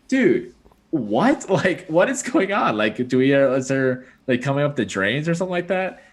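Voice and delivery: mock-exasperated questioning; retold bafflement. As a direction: replay baffled disbelief, pitch jumping up. Retells baffled reaction to mice.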